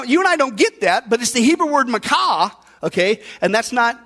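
ordinary talk, nothing marked

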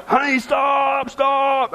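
high, slow, half-laughing call; mock chase-call to a screaming wife; calling after wife. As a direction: call after her, stretching out "stop". Chasing wife down the street.

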